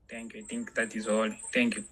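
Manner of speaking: usual speaking voice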